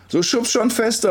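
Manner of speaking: very high, impatient cry; insistent call. A narrator's reading. Girl urges the tiring pusher, calling out. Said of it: "ruft sie".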